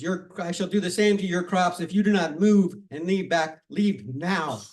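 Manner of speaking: loud, commanding warning; stern threat. Acted. Amparo warns the villagers about their crops, loud stern threat. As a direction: lay down the final ultimatum. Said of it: "She goes".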